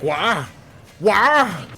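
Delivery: lightly voiced cartoon wail, rising on the repeat; a hint of whining game villain; Wario. Game villain protesting to his bosses, mild cartoon wail. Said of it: "he just like".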